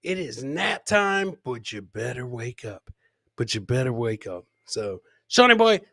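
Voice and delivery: ordinary delivery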